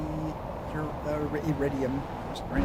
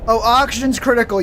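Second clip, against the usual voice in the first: alarmed shout; 6 semitones higher, 9 dB louder than usual. Real, not acted. Alarm after meteor hit.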